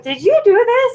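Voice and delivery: high, sharp mock accusing question; imitating a stunned accusation, pitched well up; shocked accusation. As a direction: fire it at her like you just got blindsided. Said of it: "The whole sequence where Michaela was like".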